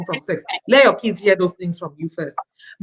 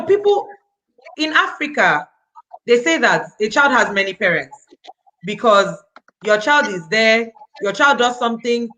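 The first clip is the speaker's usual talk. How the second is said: usual speaking voice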